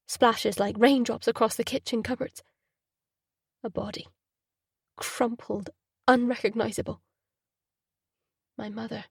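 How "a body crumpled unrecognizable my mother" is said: hushed horror. A narrator's reading. Traumatic memory.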